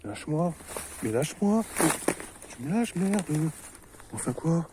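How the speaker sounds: high, loud frustrated shouting; angry yelling. Real, not acted. Stuck gamer shouting at the snag holding him, angry. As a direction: yell at the thing holding you.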